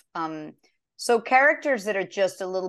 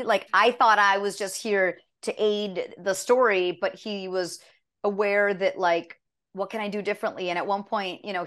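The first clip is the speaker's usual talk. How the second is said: usual speaking voice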